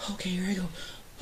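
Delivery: slow, whispered self-encouragement; slow whispered nerve-up before asking; mock whisper. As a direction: whisper it to yourself nervously. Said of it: "that's him trying to assert himself".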